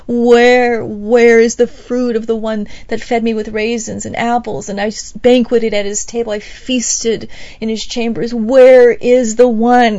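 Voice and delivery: loud, aching call for the lost beloved; longing cry. Acted. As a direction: cry out after him through the streets. Bride searching for her beloved.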